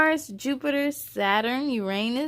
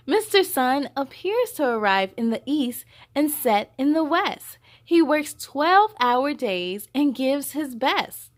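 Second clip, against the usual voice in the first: bright, louder storybook voice with higher lines; reading to children; 4 semitones higher, 6 dB louder than usual. Acted. Host reading the picture book aloud to young viewers. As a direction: read it out big and lively for little ones.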